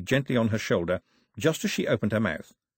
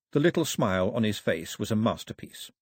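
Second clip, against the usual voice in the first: ordinary delivery, nothing marked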